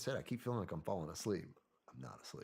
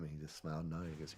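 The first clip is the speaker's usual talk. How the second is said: usual speaking voice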